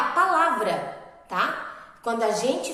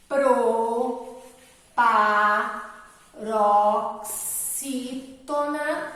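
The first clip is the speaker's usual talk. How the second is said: very slow, drawn-out proparoxítona, each syllable set apart; much slower than usual. Shown as a demonstration. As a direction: break proparoxítona into separate syllables, stretching each one. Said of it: "entender que a palavra".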